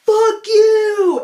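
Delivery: shrieked high, loud insult; imitating a kid screaming at a trash can; mock yell. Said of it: "and he goes".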